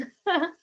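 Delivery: quick amused real laugh; a brief chuckle at the mix-up; short laugh. Real, not acted. Host greets Rebecca after the wrong button, short real laugh.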